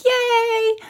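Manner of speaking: a tiny delighted squeal for the plant; high squeal of joy. Pretend. Voicing the happy plant.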